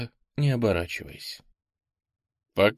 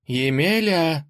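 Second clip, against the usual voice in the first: calling out slowly, higher; зовёт издалека; 7 semitones higher, 4 dB louder, slower than usual. A narrator's reading. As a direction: call his name across the field. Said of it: "позвала Василиса". (громкий оклик)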